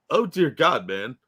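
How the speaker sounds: usual speaking voice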